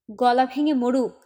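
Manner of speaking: put-on bitter, cold dismissal of the crying baby; mother snaps cruelly at her brother-in-law; bitter curse. Angry mother in quarrel.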